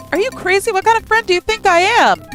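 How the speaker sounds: high, loud loyal outrage; indignant shout. Acted. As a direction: protest fiercely. Adam refuses to leave.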